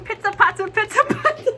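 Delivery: high, rising pizza pizza chant; racing heartbeat. Retold or imitated. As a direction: imitate a pounding heartbeat. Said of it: "my heart just going".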